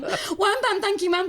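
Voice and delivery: high and bright sign-off; excited. Real, not acted. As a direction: sing it out giddily. Repeating catchphrase again.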